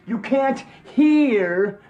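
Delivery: "You can't hear" dragged out slowly, "hear" rising; slow emphatic stress. Real, not acted. Ranting that chat can't convey voice.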